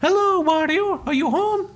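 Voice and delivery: very high cartoon Mario; sing-song high knock-knock greeting; Mario's voice. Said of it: "bangs into this big pink surface".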